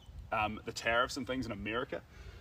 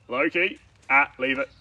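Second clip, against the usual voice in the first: loud, high call to a dog; real raised-voice reprimand to a pet; sharp dog command; 4 semitones higher, 11 dB louder than usual. Real, not acted. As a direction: call the dog off firmly and loudly. Calling off the dog.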